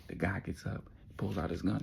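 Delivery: ordinary delivery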